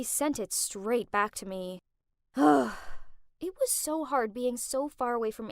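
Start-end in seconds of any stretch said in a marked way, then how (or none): 2.3-3.2 loud, exasperated sigh; big audible exhale; heavy sigh. Acted. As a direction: let out a weary huff. Exasperated sigh while telling her troubles.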